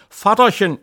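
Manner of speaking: wife lamenting to her husband, high and plaintive; wailing wife. Acted. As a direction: whine it like a worried wife. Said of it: "jammerte meine liebe Frau".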